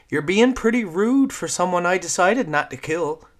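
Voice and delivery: put-on high, loud lilting Newfoundland girl; cheeky Newfoundland kid teasing; lilting Newfoundland accent. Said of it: "said the girl in a lilting accent".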